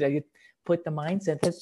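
ordinary talk

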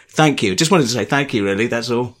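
ordinary talk, nothing marked

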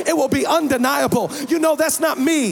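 forceful, driving sermon delivery; impassioned preaching. Real, not acted. Preacher telling the congregation God still speaks, impassioned. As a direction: build each declaration to rouse the crowd.

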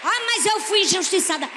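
high, loud mocking of a complainer; mocking offended churchgoer; mock whiny complaint.

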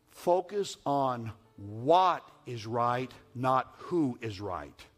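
slow, with what and who each hit hard; stress on what and who. Shown as a demonstration. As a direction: lean on what, then on who. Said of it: "preacher stressing WHAT and WHO".

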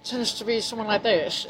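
put-on voice through pushed-out lips; lips-out character voice. Shown as a demonstration. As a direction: push the lips out and become someone. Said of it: "have my lips out here".

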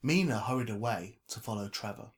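ordinary delivery

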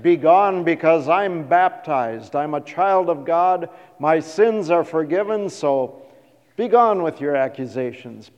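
loud, commanding rebuke with "gone" hammered; booming defiance. Shown as a demonstration. Luther loudly ordering the devil away, commanding. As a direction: cast him out at full volume. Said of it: "this is the way he said it".

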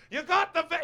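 yell. Retold or imitated. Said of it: "he was just like".

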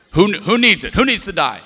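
mock-furious demand into the phone; stern dad threat. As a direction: lay down the law, loud and high. Father threatens kids over phone.

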